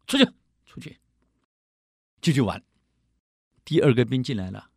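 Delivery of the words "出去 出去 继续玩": dismissive commander. Retold or imitated. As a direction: brush him away, annoyed. Commander waves the soldier off.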